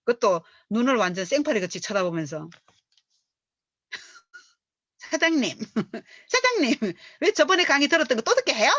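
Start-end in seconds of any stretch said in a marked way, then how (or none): 5.0-8.7 high, fast, laughing imitation of a complaining partner; mimicking a whiny partner. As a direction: parody the partner's blank-eyed complaint with a laugh. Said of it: "이래요". (파트너를 흉내 내며 웃음 섞어 높고 빠르게 투덜대기)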